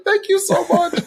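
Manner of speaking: high, tearful thank-you; choked up with gratitude; grateful, on the edge of tears; weepy thanks. Retold or imitated. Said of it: "like, weep".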